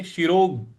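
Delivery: शिरोग with a punch on ग; stress on ग. Shown as a demonstration. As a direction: hit the ग in शिरोग. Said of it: "ग है उस पे आघात देंगे". (शिरोग में ग पर आघात)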